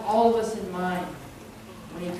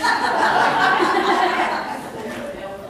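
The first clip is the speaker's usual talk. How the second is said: loud laugh; 9 semitones higher, 5 dB louder than usual. Real, not acted. Speaker laughs at own story.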